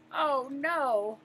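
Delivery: usual speaking voice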